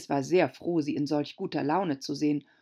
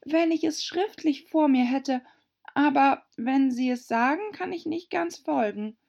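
put-on a much higher polite girl's voice; Alice, höflich; 9 semitones higher, 3 dB louder than usual. A narrator's reading. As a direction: ask very politely. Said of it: "sagte Alice sehr höflich". (Alice bittet sehr höflich, deutlich höher)

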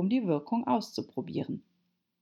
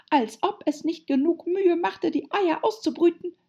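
high, loud, complaining bird voice; aggrieved pigeon; 12 semitones higher, 5 dB louder than usual. A narrator's reading. Pigeon grumbling about hatching eggs, aggrieved. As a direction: rant in a raised pigeon voice.

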